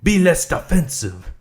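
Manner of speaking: slow, loud put-on reading voice. Mocking the author's tips, in a put-on voice. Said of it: "I'm just doing a voice".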